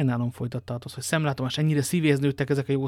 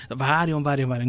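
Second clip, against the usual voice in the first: plain reading voice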